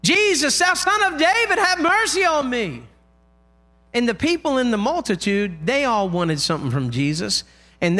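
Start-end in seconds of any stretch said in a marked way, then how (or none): 0.0-2.8 high, sustained pleading cry. Retold or imitated. Blind man begging Jesus over the crowd, crying out. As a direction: call out far above speaking pitch. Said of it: "He cries out".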